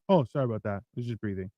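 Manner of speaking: low, soft, casual excuse; meek apology. Retold or imitated. Growler excusing himself to bystanders, softly apologetic. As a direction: drop to a quiet, innocent apology.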